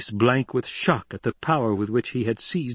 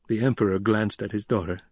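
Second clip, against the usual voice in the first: plain reading voice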